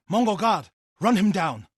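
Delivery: loud, high fighting yell; fierce battle cry; battle shout. Acted. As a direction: shout the order mid-fight.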